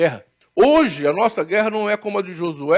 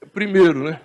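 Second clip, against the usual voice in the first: ordinary delivery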